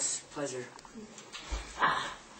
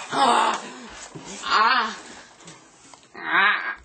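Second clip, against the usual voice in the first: pain cries; 4 dB louder, slower than usual. Acted.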